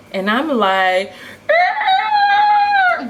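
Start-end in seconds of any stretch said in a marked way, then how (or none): 1.5-3.1 shocked scream. Retold or imitated. Shocked by peso prices.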